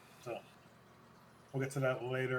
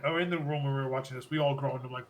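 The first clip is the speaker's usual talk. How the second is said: usual speaking voice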